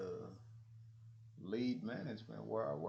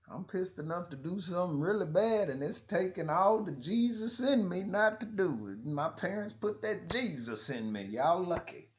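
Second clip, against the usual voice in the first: raised, higher, forceful declaration; loud heated warning; 9 semitones higher, 8 dB louder than usual. Retold or imitated. To management, loud heated warning. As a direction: warn them hard and loud.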